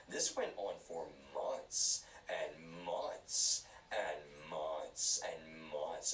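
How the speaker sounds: very slow, stretching "months and months"; drawn-out emphasis. Real, not acted. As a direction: drag each "months" out to show how long it lasted. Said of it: "Because I wanna emphasize".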